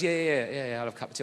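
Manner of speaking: low, easy South East London builder; lightly voiced London geezer accepting tea. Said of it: "proper South East London geezer".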